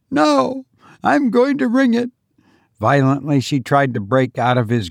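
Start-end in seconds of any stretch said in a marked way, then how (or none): 0.1-2.1 high, stubborn refusal; defiant. Acted. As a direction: dig in and refuse. Defiant mother refusing husband.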